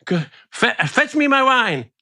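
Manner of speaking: high, flustered queen's voice; falsetto, thrown off mid-line; dazed queen. Retold or imitated. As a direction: stammer it like a rattled queen. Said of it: "In the".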